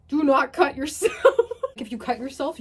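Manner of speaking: loud laughing read; laughing while reading out loud; laughing through the words. Real, not acted. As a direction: crack up mid-instruction. Reading ritual, cracking up.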